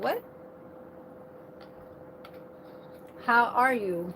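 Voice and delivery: mock confrontational how are you; a touch of irritated challenge, rising at the end; annoyed voice. Shown as a demonstration. Showing how annoyed voice sounds to someone talking, mild mock challenge. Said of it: "then my voice turns out like this".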